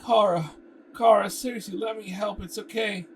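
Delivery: Kara called loud and high, seriously insisting; insistent call. Acted. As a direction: call out her name, pleading to help.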